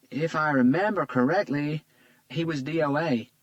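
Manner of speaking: a lightly voiced Southern nurse breaking bad news. A narrator's reading. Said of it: "very strong southern accent".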